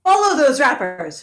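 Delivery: loud call to the party; rallying shout. Acted. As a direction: shout the order to give chase. Rallying party to follow trail.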